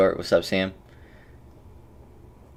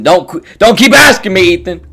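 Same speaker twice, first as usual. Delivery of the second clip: much higher, louder, fed-up outburst; annoyed yelling; 11 semitones higher, 12 dB louder than usual. Real, not acted. Streamer fed up with viewer Ethan, shouting at him. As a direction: yell it at the pestering viewer. Scolding chatter Ethan repeatedly.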